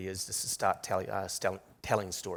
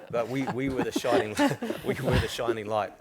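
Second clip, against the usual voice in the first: words spilling out through laughter, louder; laughing; 3 semitones higher, 7 dB louder than usual. Real, not acted. Panelist laughing while praising covid response, real laughter. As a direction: still laughing as you say it.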